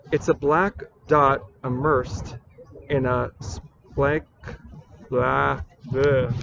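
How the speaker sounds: slow, halting recital that falls apart; botched verse, breaking off into blah noises; flubbed poem reading. Acted. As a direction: stumble on the line, then give up in nonsense syllables. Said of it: "I thought this was gonna be totally perfect".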